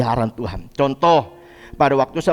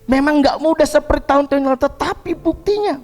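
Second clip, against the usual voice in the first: high-pitched, insistent pulpit voice; emphatic preaching; 10 semitones higher than usual. Real, not acted. Pastor assuring the congregation of God's presence, emphatic preaching.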